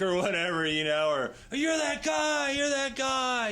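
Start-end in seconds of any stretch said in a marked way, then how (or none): 1.5-3.5 much higher, louder, then drawn out; excited fan shout. Retold or imitated. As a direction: call out like a fan spotting him. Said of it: "they go".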